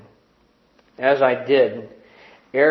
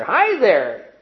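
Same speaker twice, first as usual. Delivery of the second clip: put-on excited baby greeting; 7 semitones higher, 3 dB louder than usual. A narrator's reading. Baby answers the old man.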